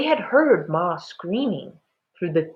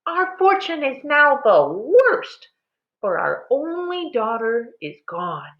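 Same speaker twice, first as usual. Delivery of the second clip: distraught wail; 8 semitones higher than usual. A narrator's reading. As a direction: lament the lost daughter, voice lifted high. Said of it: "Ma cried".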